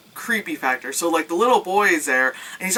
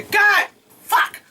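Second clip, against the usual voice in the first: mock startled curse; 4 dB louder than usual. Reacting to a loud train.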